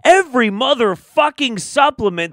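from indignant to yelling; building rant. Real, not acted. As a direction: work up to an outraged shout.

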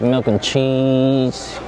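ordinary delivery

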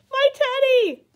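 high, loud exclamation; cried out. A narrator's reading. Eddie sees his teddy, crying out. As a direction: call it out urgently, pitched right up. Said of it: "cried Eddie".

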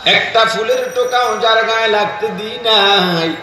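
plaintive high sermon chant; melodic preaching. Real, not acted. Chants the weeping mother's story. (করুণ সুরে উঁচু গলায় ওয়াজ)

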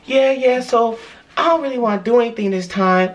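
put-on girlfriend voice. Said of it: "she talking about".

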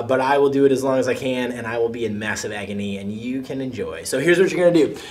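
ordinary talk

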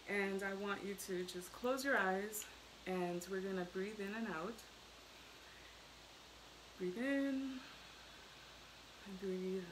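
calm, soft breathing guide; soft spa whisper. Acted. Leads breathing exercise.

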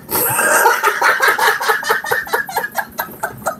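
long giggling fit; real, breath-spent laughing fit; helpless laughter. Real, not acted. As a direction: laugh on and on, losing the voice.

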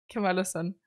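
ordinary delivery

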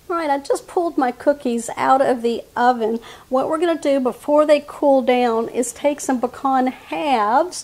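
ordinary talk, nothing marked

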